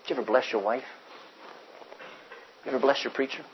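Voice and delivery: usual speaking voice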